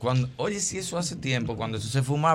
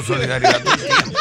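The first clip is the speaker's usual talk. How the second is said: much higher, laughing; high laughing exhortation; 4 dB louder than usual. Retold or imitated. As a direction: squeak it out laughing. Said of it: "Yo le decía al amigo mío". (exhortación aguda entre risas)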